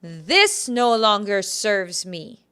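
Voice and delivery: slow, loud, weighted declaration; emphatic. Shown as a demonstration. Healer tells listeners to say it emphatically, emphatic slow declaration. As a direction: declare it emphatically, leaning on every word. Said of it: "And say it emphatically".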